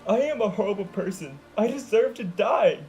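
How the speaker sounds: tearful wail to her friends. Acted. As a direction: wail out the despair. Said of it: "Sunset wailed".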